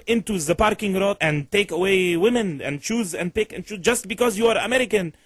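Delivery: caller in a put-on Middle Eastern accent. Prank caller complaining about his girlfriend, in a Middle Eastern accent.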